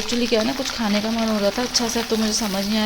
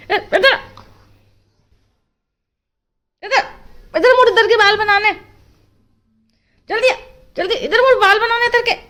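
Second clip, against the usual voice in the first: calling the dog; 13 semitones higher, 9 dB louder than usual. Real, not acted.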